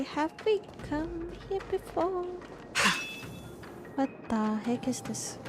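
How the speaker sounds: usual speaking voice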